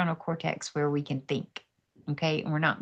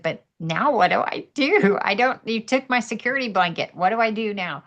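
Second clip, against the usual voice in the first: louder, higher pleading question; retold plea; 5 semitones higher, 7 dB louder than usual. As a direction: press the question, half panicked.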